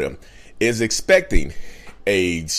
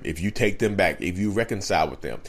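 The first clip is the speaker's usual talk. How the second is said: usual speaking voice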